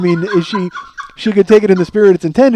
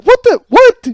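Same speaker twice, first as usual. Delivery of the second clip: much higher and louder, startled disbelief; shocked what; 10 dB louder, slower than usual. Retold or imitated. Lily seeing him walk up shirtless, high startled disbelief. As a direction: blurt it out, totally thrown. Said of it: "she just looks at me like".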